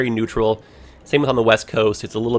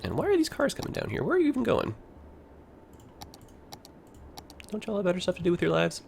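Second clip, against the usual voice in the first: mock scolding; 8 semitones higher, 7 dB quieter than usual. Scolding cars in game.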